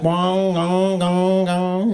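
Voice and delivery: droning imitated gong, held on one note; ringing head noise. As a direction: let each gong ring into the next. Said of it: "You start hearing shit".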